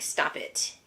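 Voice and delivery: whispered, grumpy stop it; voicing Bug, annoyed. Mother voices Bug telling the baby to stop, in a whisper. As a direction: hiss it like the sleepy annoyed bug. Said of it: "He's like".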